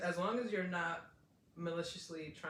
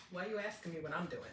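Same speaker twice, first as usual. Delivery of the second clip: ordinary talk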